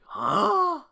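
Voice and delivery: Luffy, totally lost, in a put-on voice; Luffy huh. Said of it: "Luffy's just like".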